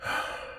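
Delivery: soft exhaled sigh; quiet sigh of grudging defeat; resigned sigh. Acted. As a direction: let out a long breath, giving in. Demon resigns to dismissal.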